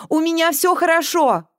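high, loud reply. A narrator's reading. Girl answers mother downstairs, loudly. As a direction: call back so it carries downstairs. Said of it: "громко сказала".

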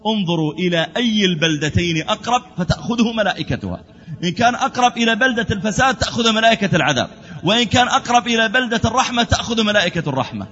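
high, ringing delivery of a ruling; emphatic preaching. Acted. Angel rules between the two bands of angels, emphatic preaching.

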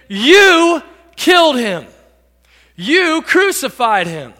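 slow, loud, drawn-out "You"; accusing stress on YOU. Acted. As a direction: land hard and long on "You". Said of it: "And Peter says to them".

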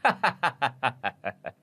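mother-in-law laughing at the mistress's offer; hearty mocking laugh. A narrator's reading. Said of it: "cười lên ha hả".

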